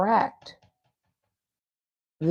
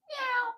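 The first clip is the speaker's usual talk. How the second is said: playful meow; 8 dB quieter than usual. Pretend. Playful cat noise after teasing.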